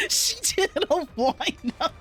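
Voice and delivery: laughing through the words; giggling hard mid-sentence. Real, not acted. Laughing at the slap.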